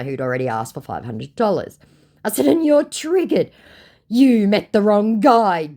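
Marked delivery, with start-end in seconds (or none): 2.5-3.5 much higher, slow and mocking; high, slow sing-song "you're triggered"; sing-song taunt. Taunting the scammer in chat. 4.1-5.7 mock macho boast. Voicing the scammer's boast.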